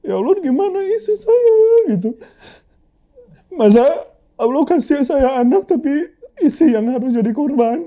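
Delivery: real sobbing plea to God; crying. Real, not acted. Crying while retelling his prayer.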